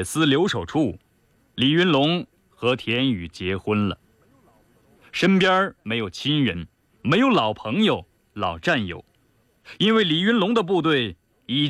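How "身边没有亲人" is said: ordinary delivery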